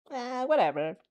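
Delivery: plain reading voice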